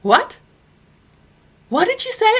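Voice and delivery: high, loud stunned what; shocked. Retold or imitated. Mother stunned by son's words in the car, high and loud. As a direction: whip round in disbelief. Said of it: "I was like".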